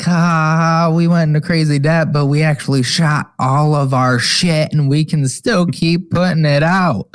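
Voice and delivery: imitating a smug, laughing winner; mock gloating laugh. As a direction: crow over rivals with spoken ha-has. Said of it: "like, laughing because".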